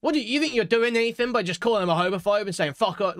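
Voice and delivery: high, lecturing mock voice, fast; mocking quote. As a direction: sneer it like a preachy know-it-all. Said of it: "and being like".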